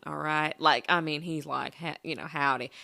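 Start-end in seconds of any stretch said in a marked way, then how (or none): 1.7-2.7 low-pitched country howdy; lightly put-on country accent. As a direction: imitate a country guy's accent. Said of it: "this guy with this country accent".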